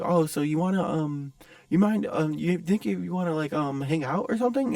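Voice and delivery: hesitant impersonation of an awkward admirer; timid stranger. Retold or imitated. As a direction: fumble nervously towards an invitation. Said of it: "and, like, timid".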